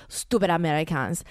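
imitating sneering Parisians in a French accent; mock French accent. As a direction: put on a disdainful French accent. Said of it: "Everyone is looking at us like".

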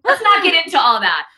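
mildly hammed-up interviewee brushing off the question; Hillary in interview. Mocking an interviewee dodging a question about dates, mock protest. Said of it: "Now I sound like Hillary in her New York Times interview".

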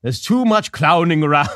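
a lightly voiced pompous villain; Sideshow Bob. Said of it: "It's like Sideshow Bob showing up".

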